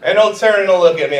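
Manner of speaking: usual speaking voice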